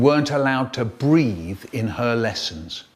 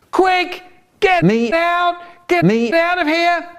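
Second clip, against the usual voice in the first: much higher, urgent begging; mock panic, voice pitched way up; panicked plea; 4 dB louder than usual. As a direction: squeal to be let out. Crying brother begs to escape.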